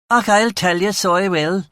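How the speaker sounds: put-on high-pitched West Belfast girl; chainsaw West Belfast accent. A narrator's reading. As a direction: rip into it in harsh West Belfast. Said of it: "the girl said in a chainsaw West Belfast argot".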